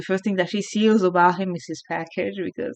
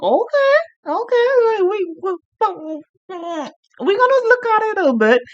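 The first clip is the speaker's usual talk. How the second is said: much higher, eager and giddy; excited reaction; 12 semitones higher than usual. Retold or imitated. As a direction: squeal out your excitement at the twist. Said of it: "I was like".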